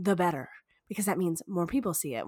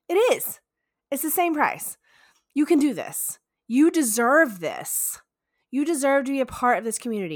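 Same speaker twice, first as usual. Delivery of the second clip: excited; 6 semitones higher, 5 dB louder than usual. Real, not acted. Hyping viewers to join.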